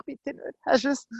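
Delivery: high-pitched anxious pleading; worried. Acted. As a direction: ask as if dreading the answer. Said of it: "Er hat Angst gehabt".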